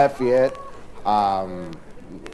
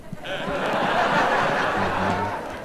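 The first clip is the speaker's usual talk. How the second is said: breathy laugh; 10 semitones lower, 5 dB quieter than usual. Real, not acted. Laugh without words.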